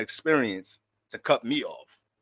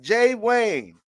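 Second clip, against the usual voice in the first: mock old neighbor; 9 semitones higher, 8 dB louder, slower than usual. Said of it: "They used to just come and be like".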